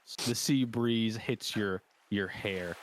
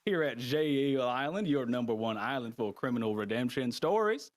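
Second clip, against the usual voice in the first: higher, showy Southern announcer; strange Southern man; 6 semitones higher than usual. Acted. Host greets criminals on his island, Southern announcer voice. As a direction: welcome the crowd like a hammy host. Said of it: "that strange southern man".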